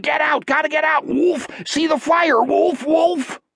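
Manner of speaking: high, frantic cries of alarm; very high-pitched, urgent outbursts; panicked screaming. A narrator's reading. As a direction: scream a warning as a terrified creature would. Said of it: "he screamed".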